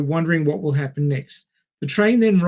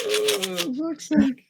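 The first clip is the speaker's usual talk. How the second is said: high, exasperated groan; 12 semitones higher, 4 dB quieter, much slower than usual. Real, not acted. Reacting to a friend's silly joke, exasperated. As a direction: roll your eyes at the joke.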